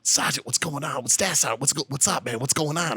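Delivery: hushed Lowell, Mass accent, imitated; Massachusetts accent. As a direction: softly pester in a Boston-area accent. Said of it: "Brown's like".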